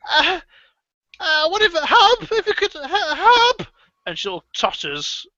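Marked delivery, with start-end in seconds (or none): 0.1-3.7 put-on high, quavering old woman's voice; frail old lady. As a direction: stammer it out like a feeble old lady.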